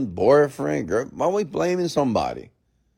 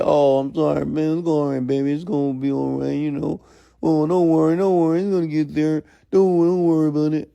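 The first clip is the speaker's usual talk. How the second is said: hammed-up coddling, higher than usual; mock consoling; 4 semitones higher than usual. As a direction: mockingly soothe them like a baby. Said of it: "You want to say".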